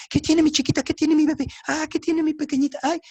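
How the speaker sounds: put-on high, doting baby-talk coo; cooing parents. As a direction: fuss over the baby like frantic parents.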